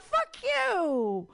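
high, loud mock outburst. Comic scolding fake vegans, loud mock outburst. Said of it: "I'm like".

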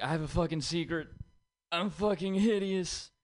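mock kid confession. Kid revealing secret at school.

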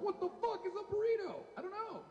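high-pitched incredulous question; comic bafflement. Acted. Mexican hearing of a super burrito, baffled.

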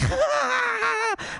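high shrieking cackle; wild hammed-up shriek; manic crack cackle. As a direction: shriek like a twitching addict. Acting out crack twitching.

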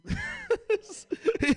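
sudden squealing laugh; high shrieking laugh. Real, not acted. High laugh at fisherman bit.

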